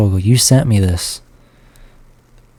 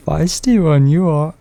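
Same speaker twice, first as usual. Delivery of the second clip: higher, slow copy of a bad impression; Yoda; 7 semitones higher than usual. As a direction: draw it out high, mimicking the clip. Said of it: "It was Yoda".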